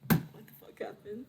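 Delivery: slow, soft, almost voiceless question; dazed waking murmur. Acted. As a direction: murmur it slowly as if just coming to.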